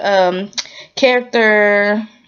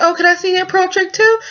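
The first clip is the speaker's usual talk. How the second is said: high squeaky breathless gush; mock swooning girl; 11 semitones higher than usual. Shop lady begging for the pearl trick, high and gushing. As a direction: ham up a smitten girl. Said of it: "And just like".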